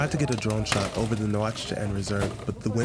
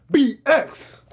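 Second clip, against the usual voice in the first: louder, high-pitched mimicked holler; imitated call-out; 8 dB louder than usual. Stranger repeats his greeting to the puzzled crew, calling out. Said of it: "And he's like".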